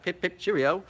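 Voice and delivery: a terrible fake British accent as a joke; mock posh British catchphrase. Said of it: "my terrible fake accent".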